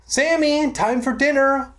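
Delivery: high calling voice up the steps; calling up the stairs. Acted. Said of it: "Mom called up the steps".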